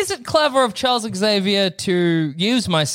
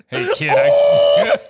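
mock shout; 6 dB louder, much slower than usual. Breaking bad news, shouted.

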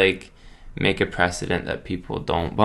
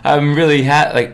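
ordinary talk

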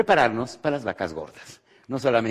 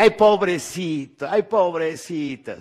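mock pity; 4 semitones higher, slower than usual. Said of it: "que todo el mundo diga".

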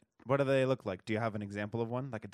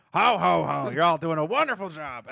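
much higher, much louder jolly Santa; cartoon toy Santa; 7 semitones higher, 11 dB louder than usual. Player mimics a movie Santa, high and booming. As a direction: squeal it like a cheery toy Santa. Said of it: "image of Santa Claus too when he turns into the toy".

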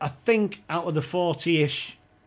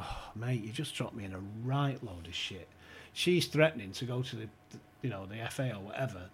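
low complaint; 5 semitones lower, 6 dB quieter than usual. Retold or imitated. Complaining to the manager.

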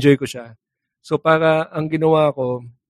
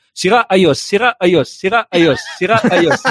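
mock Erap voice; 4 semitones higher than usual. Erap calling signal light status to Ramos, lightly mocked. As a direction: call out each blink like a dopey helper.

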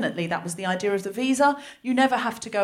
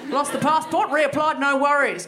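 loud, high broad Australian twang, laughing; broad Australian accent; 4 semitones higher, 4 dB louder than usual. Shown as a demonstration. Comic telling her story, sliding into broad Australian. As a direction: lay the Australian accent on thick. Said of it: "I get more Australian".

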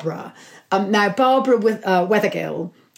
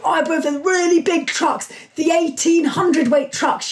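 lightly imitated lively Yorkshirewoman, pitched higher; higher-pitched quote of a hysterically funny friend; Barbara; 8 semitones higher, slower than usual. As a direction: retell her proud boast in a higher voice. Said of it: "Barbara's hysterical. She's, I can't do her accent".

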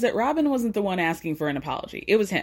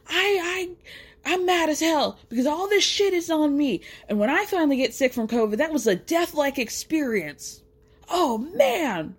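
higher, hammed-up indignant Cody; mock angry Cody; 5 semitones higher than usual. Recapper mocking Cody's complaint about covid, hammed-up indignant. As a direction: parody Cody whining about his ordeal. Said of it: "Cody's still getting angry".

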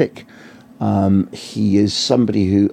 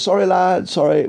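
raised, hammed-up official shout; mock bodyguard PR officer; 10 semitones higher than usual. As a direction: play the jokey bodyguard waving the press off. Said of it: "mock bodyguard P R officer tone".

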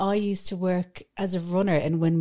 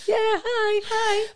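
high, bright mock greeting; awkward greeting impression; much slower than usual. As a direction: play it like a nervous hello. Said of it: "And I'm like".

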